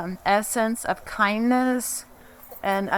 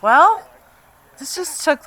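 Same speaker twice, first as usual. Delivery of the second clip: exasperated relief; 7 semitones higher, 7 dB louder than usual. Retold or imitated. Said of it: "I'm like".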